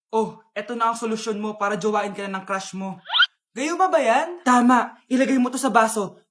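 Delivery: normal talking voice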